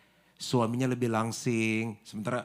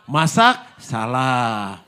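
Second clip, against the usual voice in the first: louder still, fed up; exasperated outburst; 8 dB louder, much slower than usual. Acted. Wife fed up with husband's complaints, louder exasperation.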